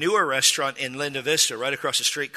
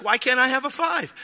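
high, whiny-comic challenge; retold mock indignation; indignant protest; 9 semitones higher than usual. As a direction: demand a reason, pitched way up.

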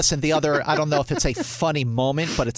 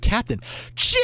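sudden joyful high cry; overjoyed Spock; 8 semitones higher than usual. Retold or imitated. Spock greets Kirk, alive after all, overjoyed. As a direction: burst out with relief and joy. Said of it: "And he's so happy".

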